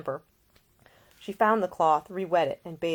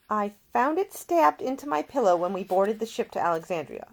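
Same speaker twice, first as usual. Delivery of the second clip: lightly voiced young woman, worried; a hint of a tense young lady; Ida's voice; 6 semitones higher, 6 dB louder than usual. A narrator's reading. Ida voiced in dialogue.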